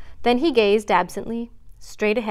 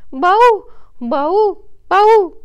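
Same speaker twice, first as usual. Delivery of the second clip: three loud, high bleats; deer bleating; 11 semitones higher, 11 dB louder, much slower than usual. A narrator's reading. Faline who cannot help herself, loud bleating. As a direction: bleat helplessly, each one blurted high and loud. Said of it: "I have to bleat".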